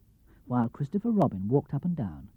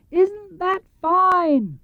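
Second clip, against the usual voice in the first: high, slow, louder bear call; shouted; 5 dB louder, much slower than usual. Acted. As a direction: call down from the balloon. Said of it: "shouted Winnie the Pooh".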